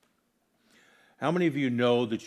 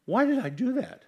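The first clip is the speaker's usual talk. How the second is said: high-pitched, loud cry of frustration at oneself; exasperated self-reproach; 13 semitones higher, 6 dB louder than usual. Retold or imitated. Scolding himself after being stubborn, high exasperated cry. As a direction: fling the question up high in dismay. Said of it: "I've told myself".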